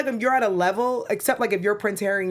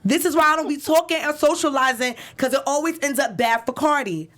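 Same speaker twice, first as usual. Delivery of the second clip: higher, raised-voice complaint; imitated tweet-rant, pitched up; 4 semitones higher than usual. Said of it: "So this is just me yelling".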